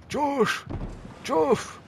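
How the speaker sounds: high, sharp call of a name. Real, not acted. Player calling teammate Josh in game, high yell. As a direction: yell for a teammate across the map.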